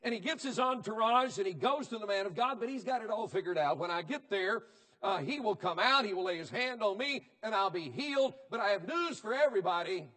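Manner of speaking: loud, high-pitched pulpit preaching; fiery revival sermon delivery; full-force preaching. Acted. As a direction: preach it hard to the congregation.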